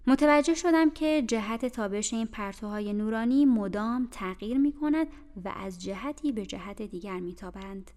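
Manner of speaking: ordinary delivery, nothing marked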